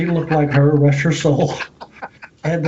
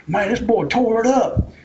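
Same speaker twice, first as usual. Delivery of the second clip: mock bandleader; 8 semitones higher than usual. Bandleader quoted in retold story.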